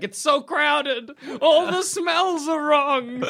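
high, slow, put-on complaining bird voice; fussy bird. Doctor Dolittle's house birds complaining, in a high slow fussy voice. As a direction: whine it like a cramped pet bird. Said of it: "the birds are like".

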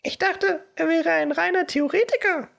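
high piping professor voice; squeaky high voice. Acted. Figurenstimme, sehr hoch. (hohe quiekende Stimme)

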